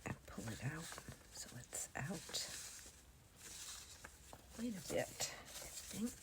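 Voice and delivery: very soft, almost voiceless; whispering. Real, not acted. Crafter concentrating on gluing the page, whispering. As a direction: murmur it close and quiet.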